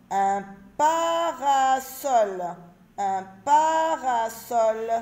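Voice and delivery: very slow, every syllable held long; slow sounding out. Shown as a demonstration. Teacher reading word list to children, very slow drawn-out syllables. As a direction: stretch each syllable evenly, as for beginning readers.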